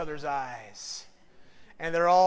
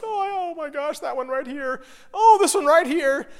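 high, mock-tearful gushing over cards; mock weepy gush; 8 semitones higher, 7 dB louder than usual. Pastor mocking himself crying in the card aisle, mock-tearful gush. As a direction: ham up a sappy sob over a card.

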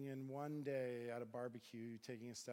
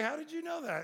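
high, loud startled question; alarmed yelp of a question; 11 dB louder than usual. Retold or imitated. Startled retort to biker challenge.

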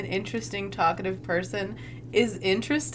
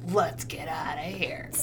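whispered urgent exit; whispered mock getaway; 5 semitones lower, 8 dB quieter than usual. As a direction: hiss it low and secret. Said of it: "he's like".

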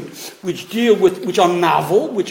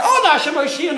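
high, eager cry of a congregation; imitated prayer yell; 8 semitones higher than usual. Teacher showing how the shul yells out Hallel, excited. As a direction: call out the plea as an excited crowd would. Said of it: "There we yell out".

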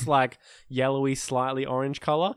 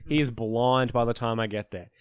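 ordinary talk, nothing marked

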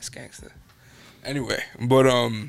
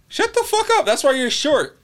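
high-pitched, raised-voice comeback; mock angry outburst; 13 semitones higher, 4 dB louder than usual. Classroom insult thrown back in a retold story, high mock outrage. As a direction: snap it out very high, playing a classroom quarrel.

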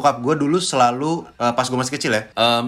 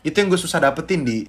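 ordinary talk, nothing marked

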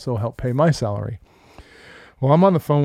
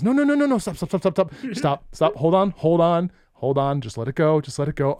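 high, hurried stop-stop pleading; urgent pleading; 4 semitones higher than usual. Retold or imitated. Boss running out of his office to stop him, urgent. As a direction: rush out to stop a fight before it starts. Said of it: "he goes".

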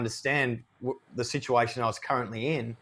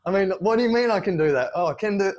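excited realization; 7 semitones higher, 5 dB louder, faster than usual. Retold or imitated. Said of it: "And I was like".

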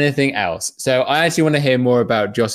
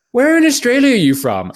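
much higher, louder lady voice; imitating a lady; 11 semitones higher, 4 dB louder than usual. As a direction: squeak it out like a chirpy stranger. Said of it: "a lady tapped me on the shoulder and said".

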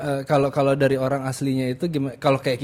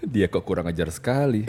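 soft, lower hammed-up soap drama line; soap opera inner voice; 4 semitones lower, 6 dB quieter than usual. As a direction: grumble it like an offended soap character. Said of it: "Kayak sinetron kan".

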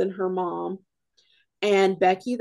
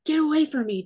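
mock yell; 8 semitones higher, 3 dB louder, faster than usual. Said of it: "started screaming at her mom".

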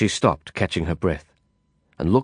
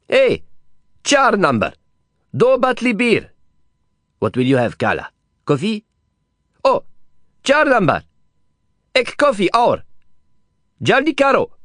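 much higher, loud call across the room; calling the waiter; 12 semitones higher, 4 dB louder than usual. Acted. Didier calling the waiter across the café, loud and high. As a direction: hail the waiter boisterously.

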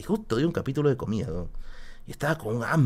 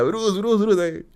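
hinchada de Matilda; 9 semitones higher, much slower than usual. Pretend. Imita escena de Matilda.

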